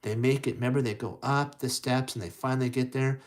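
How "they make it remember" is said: usual speaking voice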